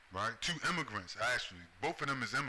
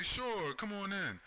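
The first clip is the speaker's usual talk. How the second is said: high, bright mock-naive voice; mock gullible welcome; naive agreement; 9 semitones higher, slower than usual. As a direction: play the trusting host, pitched way up. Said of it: "And you say".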